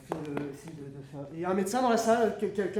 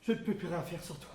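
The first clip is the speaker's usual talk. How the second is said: soft, low grieving to the money; tender lament; 4 semitones lower, 8 dB quieter than usual. Acted. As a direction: murmur to lost treasure.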